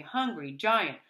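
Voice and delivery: ordinary delivery, nothing marked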